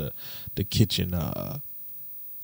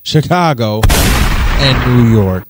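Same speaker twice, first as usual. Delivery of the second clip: loud slow call-out; 10 dB louder, slower than usual. Real, not acted. Naming the haters.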